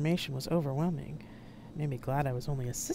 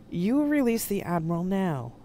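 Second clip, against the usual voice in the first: firm, raised, louder demand; steady command; 5 semitones higher, 5 dB louder than usual. A narrator's reading. Aide confronts the hostage-taker with a sword, steady command. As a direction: hold it steady and give the order. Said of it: "sounding much steadier than I felt".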